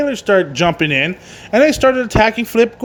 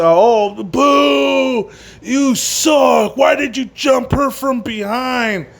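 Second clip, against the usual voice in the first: imitation crowd boo, pitched up and drawn out; mock jeering crowd; 5 semitones higher, much slower than usual. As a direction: heckle like an indignant fan, higher than usual. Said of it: "jeering and booing".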